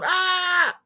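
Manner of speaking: long high mock scream; Home Alone scream. Host shows viewers the Home Alone bit, playful scream. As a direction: imitate the movie kid's drawn-out shriek. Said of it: "It's like the Home Alone".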